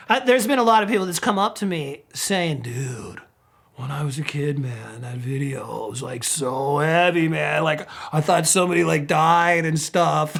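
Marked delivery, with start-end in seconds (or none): 2.6-10.3 imitating a fan. Fan telling Sebastian the video scared him, lowered mellow voice.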